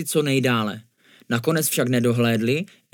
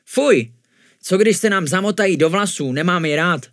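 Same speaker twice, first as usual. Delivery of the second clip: higher, louder squeamish complaint; disgusted grimace; 6 semitones higher, 5 dB louder than usual. A narrator's reading. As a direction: recoil from something yucky. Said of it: "ošklíbal se Jašek". (znechuceně, s ošklíbáním)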